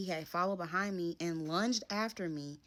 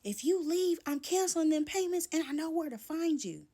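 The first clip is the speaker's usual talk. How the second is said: high, louder mock yell of a threat; retold scream; 11 semitones higher, 4 dB louder than usual. Boyfriend yelling a threat as she walks out, high mock yell. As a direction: yell it after her like a parting threat. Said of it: "he screams".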